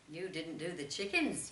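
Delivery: gently chiding him about the chickens; reproachful. Acted. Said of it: "with a note of reproach".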